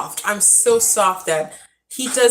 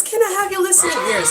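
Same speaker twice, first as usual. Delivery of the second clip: exasperated call; 10 semitones higher, much faster than usual. Retold or imitated. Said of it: "and I'm just like".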